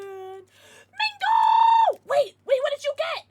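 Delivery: high excited shout at Mango; excited high yell, urgent. Real, not acted. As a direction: call out in sudden excitement. Excited call to pet/viewer.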